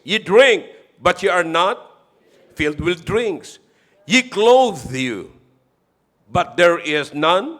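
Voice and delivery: loud, slow fervent reading of the verses; preaching scripture reading. Acted. Pastor reading Haggai aloud to the congregation, loud and slow. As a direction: proclaim each line and pause for answers.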